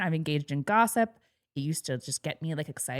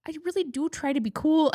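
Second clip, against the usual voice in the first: higher-pitched quoted mother-in-law; mother-in-law's voice; 10 semitones higher than usual. Retold or imitated. Retelling mother-in-law's reply to co-host, lightly imitated high voice. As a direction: put her in a higher register. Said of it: "And she was like".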